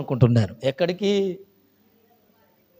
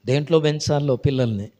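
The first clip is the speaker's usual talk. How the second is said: ordinary talk, nothing marked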